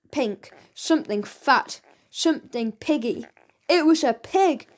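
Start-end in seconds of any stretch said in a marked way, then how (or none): 3.7-4.7 shouted. A narrator's reading. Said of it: "shouted Frank".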